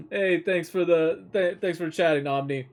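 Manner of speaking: loud, laughing thank-you to chat. Real, not acted. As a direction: thank the chatter through a laugh. Thanks chatter while laughing.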